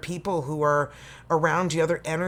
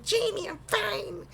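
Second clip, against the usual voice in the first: put-on high, slow childish voice; silly little voice; 3 dB quieter than usual. Mocking a viewer's comment in a high, childish voice. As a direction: talk like a sulky little kid. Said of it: "don't know why I use that voice".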